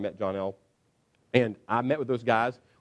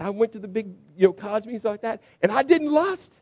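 high, rising excitement; fired up; 9 semitones higher, 4 dB louder than usual. Retold or imitated. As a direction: blurt out the victory eagerly. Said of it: "he comes in all fired up".

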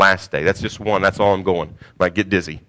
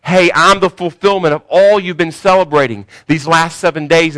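mildly raised, louder proclamation; emphatic declaration in Jesus' role; Jesus proclaiming; 5 semitones higher, 6 dB louder than usual. Acted. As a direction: announce yourself to the crowd as the fulfillment. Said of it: "He's basically saying".

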